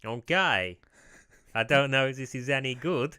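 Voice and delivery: loud, badly faked Swedish accent; tentative, wobbly attempt at Swedish. Shown as a demonstration. Trying a Swedish accent for the co-host, bad Swedish accent. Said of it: "bad attempt at a Swedish accent".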